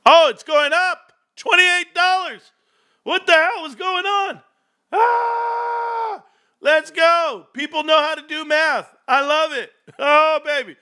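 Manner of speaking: real thrilled shouting at the chart; ecstatic. Real, not acted. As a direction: celebrate like your team just scored. Stock chart shooting up.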